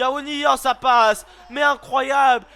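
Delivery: loud excited goal shout; French commentator roaring a goal; goal call. Real, not acted. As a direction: erupt as the ball goes in. Goal scored in live commentary.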